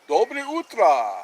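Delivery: loud, drawn-out Russian good morning; Russian greeting. Shown as a demonstration. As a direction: call out the greeting in Russian, high and slow. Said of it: "That's how they say it in Russian".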